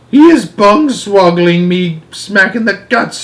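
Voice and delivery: high, indignant giant's shout; shouted complaint. Acted. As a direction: cry out as if just kicked. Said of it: "shouted the gizzard gulper".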